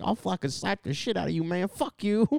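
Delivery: high-pitched mock tirade; imitated arguing Beetlejuice.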